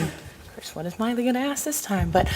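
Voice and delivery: ordinary talk, nothing marked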